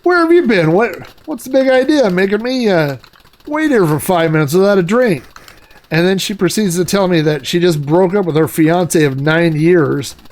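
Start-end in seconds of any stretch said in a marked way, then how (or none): 0.0-5.2 mock indignant. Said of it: "I go".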